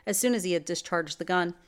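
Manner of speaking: ordinary delivery, nothing marked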